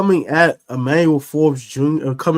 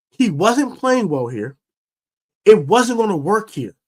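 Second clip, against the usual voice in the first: heated rant; 8 semitones higher than usual. Real, not acted. Host ranting to fans about the Forbes criticism, heated and climbing. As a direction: let the frustration boil over. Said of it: "I'm gonna go on a mini rant".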